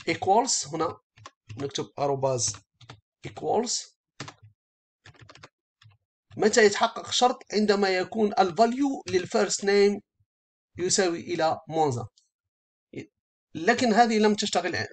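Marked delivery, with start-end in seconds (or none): none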